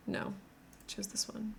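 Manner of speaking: whispered to herself; whispering. Real, not acted. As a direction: breathe it without voice. Muttering to herself, unvoiced.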